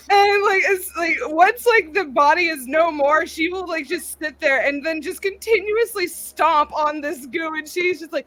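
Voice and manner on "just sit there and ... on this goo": normal talking voice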